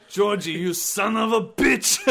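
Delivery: slow, loud mumbling tough-guy voice; Sylvester Stallone. Acted. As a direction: growl it like a boxer hero. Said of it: "What, Sylvester Stallone?".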